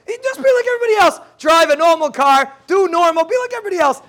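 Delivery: high, fast insistent push; emphatic urging. Real, not acted. Teacher urging students to fit in, high insistent push. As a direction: press the point hard to the class.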